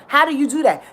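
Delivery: normal talking voice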